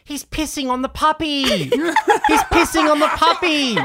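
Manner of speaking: high, loud, horrified yelling; horrified shout. Retold or imitated. Woman at the dog park shouting in horror at the dog. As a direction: cry out in shock at the scene. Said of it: "the woman went".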